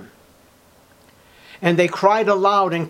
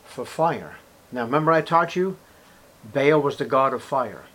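usual speaking voice

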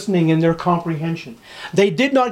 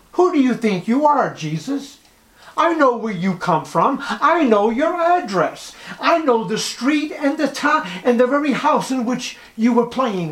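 how dare you, fling it at him from a raised pitch; indignant townsfolk; 6 semitones higher than usual. Acted. Acted indignant Nazareth townsfolk.

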